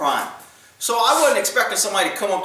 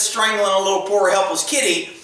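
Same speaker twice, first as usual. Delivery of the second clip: normal talking voice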